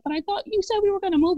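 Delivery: high-pitched, upset pleading; emotional protest. Retold or imitated. Wife pleading with husband over the move, emotional. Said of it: "it was so emotional".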